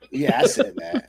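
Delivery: sheepish laugh through the words. Real, not acted. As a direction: laugh it out, caught swearing. Laughs after swearing.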